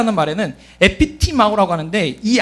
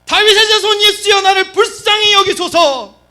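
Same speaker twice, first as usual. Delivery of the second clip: high, insistent shouted plea; desperate repeated cry for mercy; crying out louder; 10 semitones higher, 4 dB louder than usual. Acted. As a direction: shout it high, holding the words. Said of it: "더욱 크게 소리질러 이르되".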